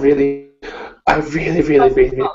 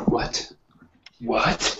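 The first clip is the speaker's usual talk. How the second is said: hushed whisper in a library; 2 semitones lower, 8 dB quieter than usual. Real, not acted. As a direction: keep it to a whisper so nobody hears. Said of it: "why are you whispering?".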